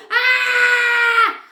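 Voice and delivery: high, loud squeal of joy; excited scream. Real, not acted. Vlogger gets Happy Mail, excited scream. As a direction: scream with delight.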